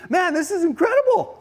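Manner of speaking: high-pitched marvelling exclamation; elated disbelief. Acted. Fisherman Peter set for the week, high elated amazement. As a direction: burst out amazed at your luck. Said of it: "first thing he's thinking is".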